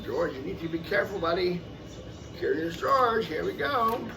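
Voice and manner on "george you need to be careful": usual speaking voice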